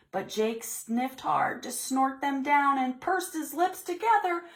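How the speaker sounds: ordinary delivery, nothing marked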